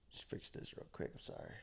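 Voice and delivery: ordinary talk, nothing marked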